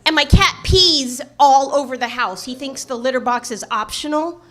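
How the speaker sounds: normal talking voice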